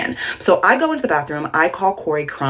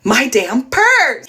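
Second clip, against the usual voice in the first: high, drawn-out retold outburst; exasperated plea; 5 semitones higher, 3 dB louder, much slower than usual. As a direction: stretch it out into a frustrated cry. Retold frustrated plea for lost purse.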